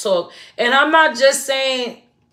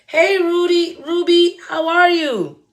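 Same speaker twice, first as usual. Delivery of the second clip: bright, higher friendly hello; cheerful greeting; 5 semitones higher, slower than usual. Retold or imitated.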